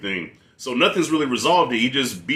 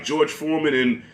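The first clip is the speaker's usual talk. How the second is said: normal talking voice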